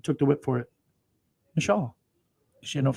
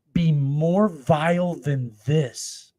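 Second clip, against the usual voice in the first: drawn-out, solemn biblical line; slow solemn read of "be more vile than this"; slow scripture reading; 2 semitones higher, much slower than usual. Shown as a demonstration. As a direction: stretch each word like holy writ. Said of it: "scripture read, then paraphrased".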